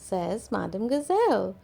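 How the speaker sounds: ordinary delivery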